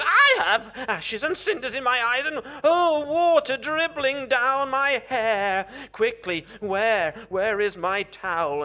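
high, very loud flustered wail; frantic shouting. A narrator's reading. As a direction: shout desperately for help. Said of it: "Herr Korbes shouting".

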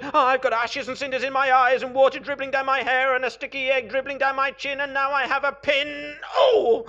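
high, very loud, fast rant; frantic wail. Acted. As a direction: reel off the woes at top volume. Character wails after sitting on pin.

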